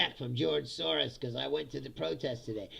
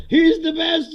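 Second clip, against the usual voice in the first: high, loud gushing praise; hammed-up fan cheer; mock adoring fan; 11 semitones higher, 14 dB louder, slower than usual. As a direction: gush like a fawning bot account. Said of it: "And they're like".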